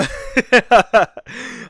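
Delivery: high, loud burst of laughter; real cackling laugh. Real, not acted. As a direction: crack up at your own joke. Laughs after joking insult.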